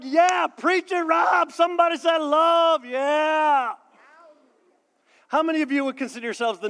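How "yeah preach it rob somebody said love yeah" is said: loud, high shouting amen; excited congregant. Retold or imitated. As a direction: shout back like a fired-up churchgoer. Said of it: "You are all like".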